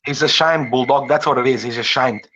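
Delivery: normal talking voice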